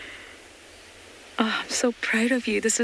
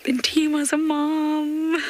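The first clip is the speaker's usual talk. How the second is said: overjoyed; 8 semitones higher, 3 dB louder, much slower than usual. Real, not acted. Guide overjoyed at new cub.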